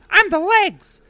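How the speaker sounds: very high indignant goblin; squeaky goblin. Acted. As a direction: insist in a tiny goblin squeak. Goblins bicker with each other.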